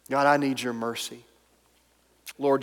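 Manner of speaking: normal talking voice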